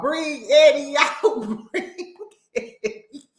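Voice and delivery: high, drawn-out call collapsing into laughter; mock summons dissolving in giggles; mimicking while cracking up. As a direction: imitate them slowly, then lose it laughing. Mimics mama and sister, cracks up.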